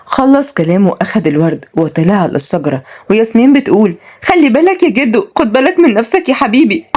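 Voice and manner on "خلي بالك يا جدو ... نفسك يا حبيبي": put-on high, playful young woman; amused granddaughter in a raised pitch; teasing warning. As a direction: call out mock concern ending in laughter. Granddaughter teasingly warns climbing grandfather.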